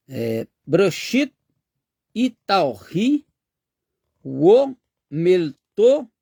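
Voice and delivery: slow, careful Aramaic read aloud, syllable by syllable. Shown as a demonstration. Teacher reads the estrangelo text to the class, slow and careful. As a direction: sound out each Aramaic word slowly. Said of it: "vou ler aqui no estranguelo primeiro".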